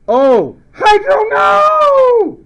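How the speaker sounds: high, loud comic scream; over-the-top theatrical shriek; screamed mnemonic. Shown as a demonstration. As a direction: scream it with a long wailing no. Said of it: "you screaming".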